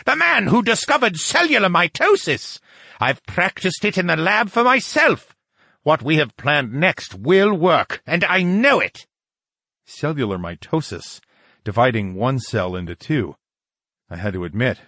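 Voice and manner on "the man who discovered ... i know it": high, outraged boasting; shrieking. A narrator's reading. Lucian defending his work to a doubter, shrieking. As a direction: keep it pitched up, insisting furiously. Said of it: "Lucian shrieked".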